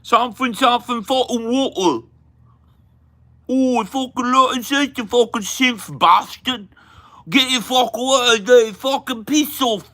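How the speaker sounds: high, loud mock-Cockney gibberish; thick Cockney accent. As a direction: yell it in a mangled Cockney caricature. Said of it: "have such thick Cockney shit".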